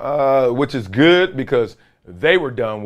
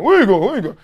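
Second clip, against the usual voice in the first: mock coach's call; 6 semitones higher, 4 dB louder, much faster than usual. Coach Parcells chasing the player.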